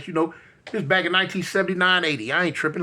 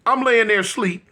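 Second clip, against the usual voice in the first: ordinary delivery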